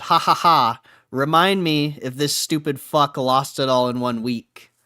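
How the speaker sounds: mock the jeer loudly; loud jeering read of a troll. Reading a mocking commenter.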